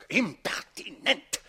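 slow, loud indignant outburst; indignant slow exclamation; outraged. Acted. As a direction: hurl the word in outrage. Count outraged at the confession.

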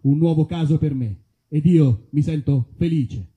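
ordinary delivery

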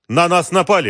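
high, urgent cry; alarmed report. A narrator's reading. Bodyguard reporting an attack, alarmed. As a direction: call out the attack. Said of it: "ответил один из телохранителей".

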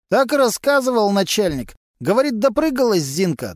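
a sly garage lowlife gossiping to cops; Vasily, a gruff mechanic. A narrator's reading.

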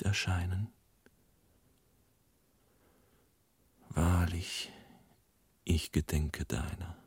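half-whispered calls to a lost friend; hushed lament. Retold or imitated. Friend repeats the dead man's dream words, hushed and partly whispered. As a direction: breathe the words as if into the dark. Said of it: "Ich spreche die vernünftigen Worte nach".

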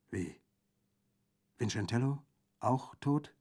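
a touch of horror; shuddering question. A narrator's reading. Orso learns Vincentello is dead, mildly shuddering. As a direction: ask haltingly, mildly appalled, with pauses between words. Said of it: "fragte Orso erschaudernd".

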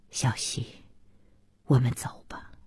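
soft, low, near-whispered coaxing; very quiet and low; gentle hushed plea. Acted. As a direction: murmur it tenderly while holding someone close. Soft plea while embracing her.

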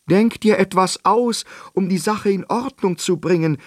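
sehr hoch und laut gerufen; verzweifelter Ruf. Acted. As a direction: der König fleht drängend um eine Lösung. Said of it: "rief der König". (König ruft dem Zauberer verzweifelt zu, hoch und laut)

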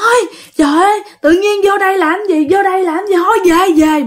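high, insistent whining refusal; balking protest. Retold or imitated. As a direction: protest being dragged inside. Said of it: "cứ dùng nhớ".

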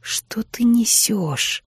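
very slow, tired whisper; quiet, weary. Acted. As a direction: breathe it out, worn down. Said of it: "Голос Миртл был тихим и утомлённым". (тихий, утомлённый шёпот)

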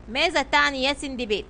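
ordinary delivery, nothing marked

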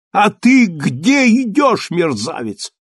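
slow, high angry yell; angry shout. Acted. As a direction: yell at the soldier. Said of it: "крикнул поручик".

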